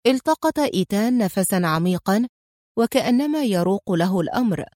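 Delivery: plain reading voice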